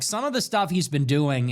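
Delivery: normal talking voice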